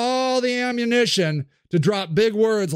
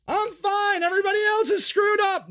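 high defensive insistence; 10 semitones higher than usual. Acted. Sage insists.

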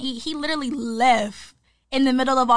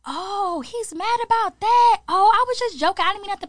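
mock surprised backpedal; 8 semitones higher, 4 dB louder than usual. Imitating friend brushing off her husband comment, mock surprised. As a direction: imitate someone acting shocked, pitched well up.

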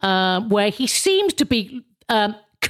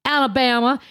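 mock Alabama twang; Alabama accent; 3 semitones higher than usual. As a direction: imitate an Alabama accent, slightly raised. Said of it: "She's Alabama".

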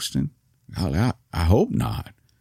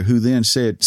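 ordinary delivery, nothing marked

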